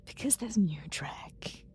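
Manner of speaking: excited stage whisper. Acted. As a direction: whisper it like a thrilling secret. Excited hush about new Trek.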